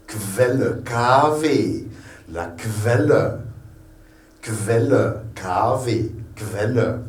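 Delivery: "Quelle" pronounced KVEL-le, cluster sounded. Shown as a demonstration. Teacher drilling German Q-U, KV sound. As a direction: start "Quelle" on K plus V. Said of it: "ça devient un K-V".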